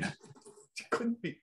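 very high, slow incredulous whine; mock disbelief. Real, not acted. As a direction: stretch it out in fake disbelief. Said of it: "mocking disbelief".

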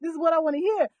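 high-pitched, gleeful retold cheer; excited egging-on. Roommate sitting up in bed, excitedly egging her friend on. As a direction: hype up a friend for a showdown. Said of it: "I'm excited about this".

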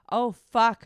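loud high cry; screamed. Acted. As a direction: scream it out in pleasure. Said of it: "she screamed".

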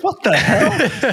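high, slow laughing disbelief; stunned half-laugh; shocked. Retold or imitated. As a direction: squeal it out in amused shock. Shocked at near crash.

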